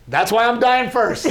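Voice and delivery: high, loud mock-distraught cry; comic outburst. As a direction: blurt it out loudly through laughter. Comic outburst after the sad story.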